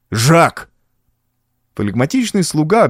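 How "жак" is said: higher angry call; sharp summons. A narrator's reading. Count to his servant, angry call.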